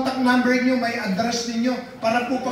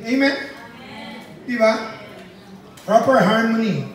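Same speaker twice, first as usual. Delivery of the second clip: preacher hammering out numbered points; forceful preaching; 4 dB louder than usual. Acted. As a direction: drive each point home to the church.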